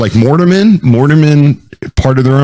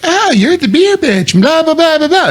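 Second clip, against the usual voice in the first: mock bar girl. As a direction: imitate an obnoxious girl, pitched way up and trailing into blah-blah.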